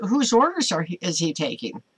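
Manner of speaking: ordinary delivery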